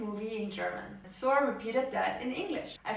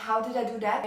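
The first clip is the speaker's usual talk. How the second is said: ordinary talk, nothing marked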